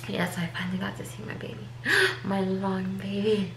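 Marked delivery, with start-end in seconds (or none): 1.7-2.2 sharp, much higher gasp of joy; delighted gasp. Real, not acted. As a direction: catch your breath in wonder. First seeing her baby.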